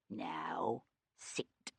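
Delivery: unvoiced hushed order; whispered command.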